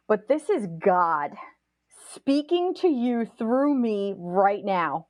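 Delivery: slow, weighty words with deliberate pauses; solemn pronouncement. Retold or imitated. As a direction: retell it like a slow, momentous revelation. Roommate's solemn pronouncement, retold.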